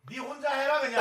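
normal talking voice

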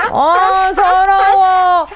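high, very slow whimper holding back sobs; mock held-back crying. Listener's child told to stop crying, whimpering high and slow. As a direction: act a child swallowing tears. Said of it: "이렇게".

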